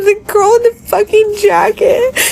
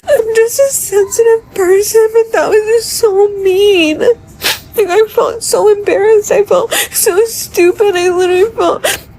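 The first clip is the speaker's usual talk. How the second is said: real tearful hurt, voice raised in pitch; 3 semitones higher than usual. Real, not acted. Upset traveler recounting her humiliation, tearful. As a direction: protest through tears.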